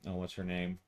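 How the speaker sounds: ordinary talk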